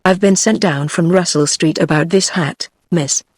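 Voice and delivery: plain reading voice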